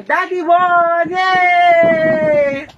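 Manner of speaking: excited cheer. Real, not acted. Announcing winner of challenge.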